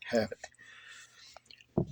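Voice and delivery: usual speaking voice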